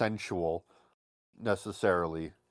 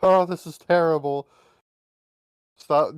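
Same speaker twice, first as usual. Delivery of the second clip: mock protesting girl; 5 semitones higher, 6 dB louder than usual. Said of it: "they're always just like".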